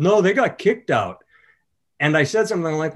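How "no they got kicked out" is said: a higher, mildly louder call; audience member calling out. Retold or imitated. Audience member tells the comedian the table was kicked out, calling out. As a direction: call it up to the stage. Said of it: "someone yelled".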